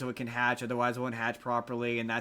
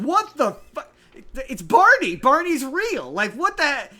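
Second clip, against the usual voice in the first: much higher, loud shocked cry with a laugh; freaking out; 11 semitones higher, 10 dB louder, slower than usual. Retold or imitated. Grandparents meeting Barney for the first time, loud shocked high cry. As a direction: shriek in disbelief. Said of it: "they freak out".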